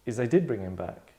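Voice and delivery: ordinary delivery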